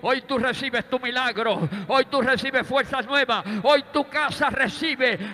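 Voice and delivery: loud, rising preacher's cry to the congregation; full-force preaching. Acted. Preacher proclaiming God's promise of healing to the church, loud and rising.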